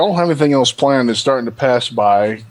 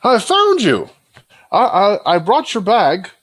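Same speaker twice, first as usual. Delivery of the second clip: high, breathless eager voice; a hint of an overexcited helper; eager NPC voice; 9 semitones higher than usual.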